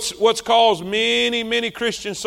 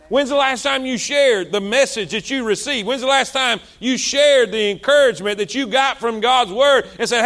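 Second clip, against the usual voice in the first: usual speaking voice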